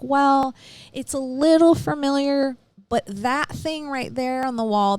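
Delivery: imitating her nervous, wavering answer; nervous hedging. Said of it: "she's starting to get a little nervous".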